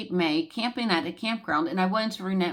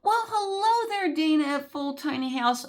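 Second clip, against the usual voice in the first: excited greeting; 8 semitones higher than usual. Real, not acted.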